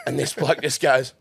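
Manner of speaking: usual speaking voice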